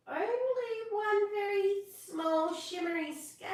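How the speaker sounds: high sing-song musing; tempted fish singing to himself; sing-song thought. Acted. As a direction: muse aloud in a lilting tune. Rainbow fish's thought, sung.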